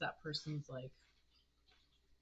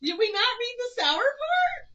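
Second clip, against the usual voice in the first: laughing; 12 semitones higher, 7 dB louder than usual. Real, not acted. Laughing at sour candy.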